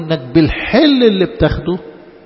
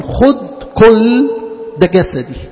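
drawn-out liturgy; 4 semitones higher, 4 dB louder, slower than usual. Acted. Repeats liturgy colloquially.

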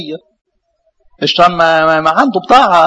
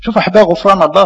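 normal talking voice

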